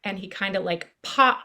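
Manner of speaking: ordinary delivery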